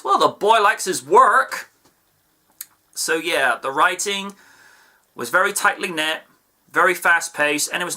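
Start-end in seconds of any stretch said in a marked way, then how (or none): 0.0-1.7 higher, louder and slow, a hint of a cocky turtle; lightly voiced wisecracking hero; Raphael impression. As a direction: toss off the line like a wry Ninja Turtle. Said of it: "to quote Raphael from the nineteen eighty-seven Ninja Turtle series".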